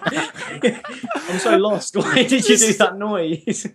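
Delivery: slow, high laughing disbelief. Real, not acted. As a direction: giggle through the words. Laughing at a weird noise.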